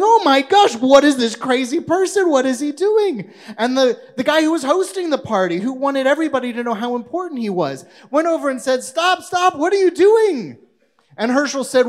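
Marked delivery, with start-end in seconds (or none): 0.0-3.3 high, loud, scandalised exclamation; shocked gasp. Acted. Party guests gasping at Herschel stuffing his pockets, high and loud. 8.8-10.6 high, loud, frantic order to stop; alarmed outcry. Acted. Host yelling at Herschel to stop stuffing food, high and loud.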